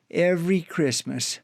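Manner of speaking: usual speaking voice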